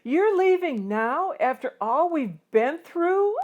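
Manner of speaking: high, loud angry cry at his father; shouting. Acted. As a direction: shout it out in disbelief. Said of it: "I shout".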